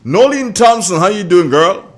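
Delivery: loud, high, beaming greeting; hearty on-air hello to a friend; excited shout-out. Real, not acted. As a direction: call out her name across the airwaves. Radio host calls out to listener.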